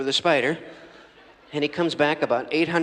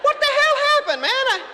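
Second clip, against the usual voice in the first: high, loud squeaky spider voice, wailing; a tiny creature yelling about its lost home; outraged spider; 14 semitones higher, 4 dB louder than usual. Acted. Spider returns to find his home gone.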